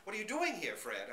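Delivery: high, loud eager question; excited greeting. Acted. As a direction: bounce up to greet him. Dog excitedly greets Fred.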